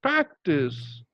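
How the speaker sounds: "practice" with a strong PRAC and a falling, light TICE; stress on PRAC. Shown as a demonstration. Teacher modelling syllable stress, "practice" stressed on PRAC. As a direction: lean on PRAC at the start of "practice". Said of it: "how to stress upon this syllable".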